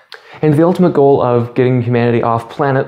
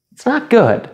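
squeaky dismay; 10 semitones higher than usual. Acted. Sudden high squeak.

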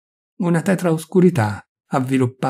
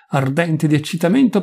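usual speaking voice

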